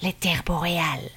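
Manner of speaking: put-on breathy whisper of an old man; whispered after sighing. A narrator's reading. Elder names the land to invade, whispered. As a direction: whisper it out like a long sigh. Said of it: "Il y eut d'abord un long soupir".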